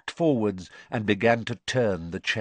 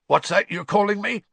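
high-pitched, indignant challenge; defiant; 10 semitones higher, 4 dB louder, faster than usual. A narrator's reading. Old servant challenging the cold voice that insulted him, defiantly. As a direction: bristle back at the insult. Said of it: "said Frank defiantly".

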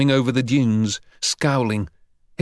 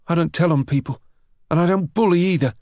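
lightly voiced higher-pitched schoolboy; defensive boy; 6 semitones higher, faster than usual. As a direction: protest your innocence a little higher. Harry defends himself to bully.

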